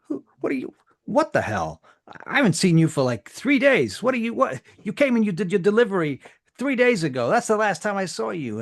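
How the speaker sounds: very high, loud incredulous protest; indignant runner. Acted. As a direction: squawk in disbelief.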